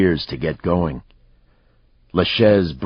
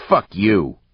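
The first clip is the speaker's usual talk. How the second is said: raised, loud curse; angry rebuff; 5 semitones higher, 5 dB louder than usual. A narrator's reading. Fighter rebuffing the man who intervened, angry. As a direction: spit back a defiant curse.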